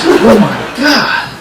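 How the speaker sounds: slow, high-pitched disbelief; mock dismay. Paul sees his friend gone along the ledge, aghast. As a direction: draw it out, aghast, as in a retelling.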